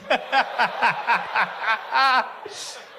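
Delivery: long breathy fit of laughter; helpless laughter. Real, not acted. Comic can't stop laughing at the faller, laughing helplessly. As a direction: let the laugh roll out.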